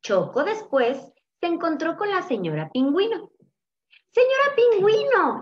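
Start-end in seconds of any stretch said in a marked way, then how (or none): 4.1-5.4 high, loud chick calling out; excited high-pitched hail; exclaiming. A narrator's reading. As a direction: exclaim the name in a squeaky-high voice. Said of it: "exclamó".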